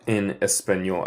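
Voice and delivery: ordinary talk, nothing marked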